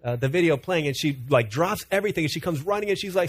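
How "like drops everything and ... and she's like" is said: ordinary delivery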